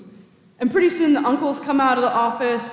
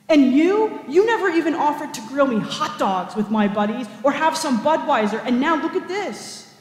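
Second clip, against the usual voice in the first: bitter rage; 5 semitones higher than usual. Acted. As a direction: spit the words out, scornful and indignant. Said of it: "nearly spitting in an absolute rage".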